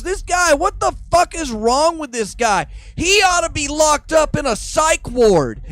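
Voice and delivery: high, loud indignant rant; outraged yell. Retold or imitated. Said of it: "And Joe Rogan goes".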